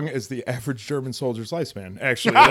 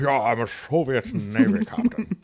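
Sean Connery. Retold or imitated. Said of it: "Sean Connery".

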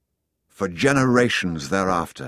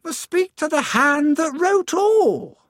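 the sun speaking, much higher and louder; lofty voice of the sun, pitched way up; warm beaming sun voice; 6 dB louder than usual. Acted. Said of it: "said the sun".

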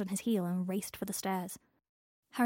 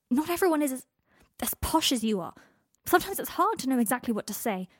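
defensive; 4 semitones higher, 6 dB louder than usual. Acted. Harry defensive shout.

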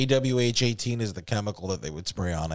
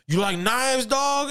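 high-pitched, eager dealer voice; hammed-up, pitched well above normal; hyped-up dealer; 12 semitones higher, slower than usual. The coke dealer to a nervous young buyer, hyped up.

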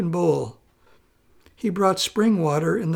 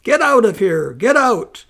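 loud, high, raging wife driving him out; wife screaming at her husband to get out; furious scream; 4 semitones higher, 8 dB louder than usual. Acted. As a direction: scream him out of the room in a rage. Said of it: "flew into a rage and screamed at him".

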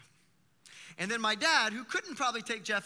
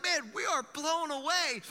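slow, higher, louder awe at what just happened; amazed disciples; 7 semitones higher, 5 dB louder, slower than usual. Retold or imitated. Disciples returning to Jesus, stunned and thrilled. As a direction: let the wonder spill out slowly. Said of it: "The disciples are like".

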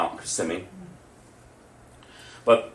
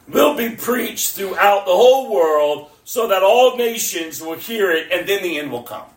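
high, raised preaching voice; impassioned preaching; 11 semitones higher, 9 dB louder than usual. Real, not acted. Preacher proclaiming good news to the church, impassioned.

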